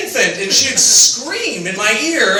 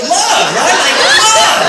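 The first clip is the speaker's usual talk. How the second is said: gushing; 8 semitones higher, 6 dB louder, much slower than usual. Acted. Comic gushing about love.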